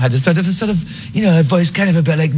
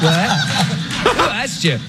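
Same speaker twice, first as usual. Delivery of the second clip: shocked; 8 semitones higher, much slower than usual. Retold or imitated. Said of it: "And I was like".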